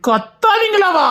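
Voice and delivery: slow, high, indignant echo; angry shouting. Acted. Father outraged at being hushed by son, angry shout. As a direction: draw it out in a high yell. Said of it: "அப்பா கத்தாதீங்கப்பா".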